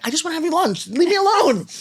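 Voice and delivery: high, exasperated mock plea to be left alone; mock whiny protest. Arguing with her spirit guide over lunch, mock whiny protest. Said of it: "And I'm like".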